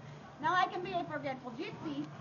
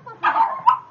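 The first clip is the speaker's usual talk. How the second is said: woof woof huzzah yelled high and loud; loud cheer; 15 dB louder, slower than usual. Shown as a demonstration. Host demonstrating the cheer to the crowd: full yell. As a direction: belt huzzah out like a rallying cry. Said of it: "will you demonstrate woof".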